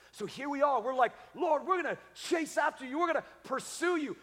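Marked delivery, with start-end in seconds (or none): 1.3-4.2 eager pledge. Acted. Mimics believers pledging pursuit.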